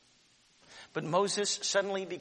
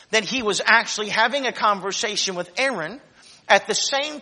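raised, emphatic sermon voice; preaching; 6 semitones higher, 10 dB louder than usual. Acted. Congregation hears the closing point preached. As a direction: pastor drives home the point to the congregation, preaching.

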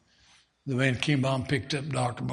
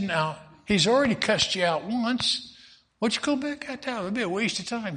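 higher-pitched wife's voice; a wife asking where he's going, voiced higher; worried wife; 8 semitones higher than usual. Wife asking her husband where he's going, higher voice.

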